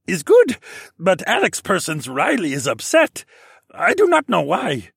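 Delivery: very high, loud squeaky creature voice; pigeon-speak voice. Acted. Pigeon-like steed calls to Alex through their mind, high and squeaky. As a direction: chirp it out like a little pigeon creature. Prosper's voice again.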